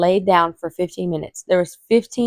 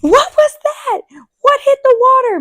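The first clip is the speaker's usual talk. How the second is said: much higher, mock-startled husband voice; imitating her husband jumping at the sound, pitched way up; 4 dB louder than usual. Husband hearing her water break, high startled imitation. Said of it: "And he was like".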